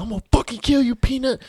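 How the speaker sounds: hammed-up chase yell; furious threat. Boyfriend chasing, furious threat.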